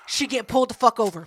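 ordinary talk, nothing marked

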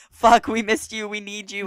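high, loud burst of relief; mock desperate outburst. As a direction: blurt it out as the kids. Siblings voiced greeting Fiona.

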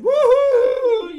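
huge high slow woohoo cartoon yell; Sport Goofy yell. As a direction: belt it like a cartoon dog cheering, high and slow. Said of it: "you hear Sport Goofy".

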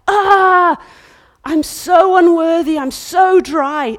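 high loud shout slowing to a drawn cry; shouted anguish of the bride. Acted. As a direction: wail your unworthiness. Bride voiced crying out.

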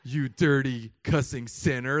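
slow, loud, low self-righteous scolding; mock preachy condemnation. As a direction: thunder it like a judgmental church lady. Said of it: "That's exactly what I didn't say".

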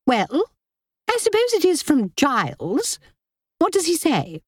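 high, snappish society lady; haughty sister-in-law. Acted. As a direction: press with sharp impatience. Linda demands news from Kitty.

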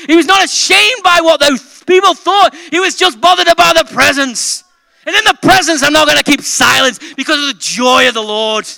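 loud, high, impassioned pulpit shouting; rally the congregation at full voice; full-force preaching. Real, not acted. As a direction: build to a fervent, shouted proclamation.

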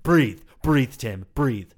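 loud, high urgent call to breathe; loud urgent order from the friend to the crying actor; urgent command. Retold or imitated. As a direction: snap him out of it, loud and sharp. Friend commands him on stage.

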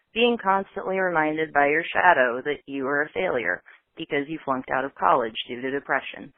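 usual speaking voice

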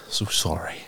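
sheepish whispered aside; whispering. Real, not acted. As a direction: whisper an embarrassed apology. Embarrassed aside after losing place.

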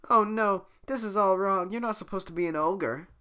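high, loud dismayed princess. Acted. Voicing Fiona.

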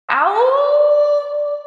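loud, high howling "Ow"; wolf howl. Acted. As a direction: howl like a wolf to draw attention. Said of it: "Just super loud wolf howl".

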